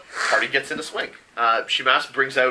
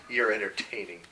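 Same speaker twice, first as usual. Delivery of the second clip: lower-key, softer deadpan reply; dry retort; 3 semitones lower, 7 dB quieter than usual. Acted. Server answers Gendo back, quiet dry retort. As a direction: drop it quietly as a comeback.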